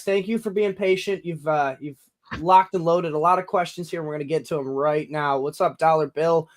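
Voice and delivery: normal talking voice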